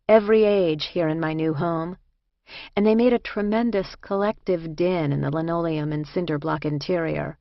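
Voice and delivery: ordinary delivery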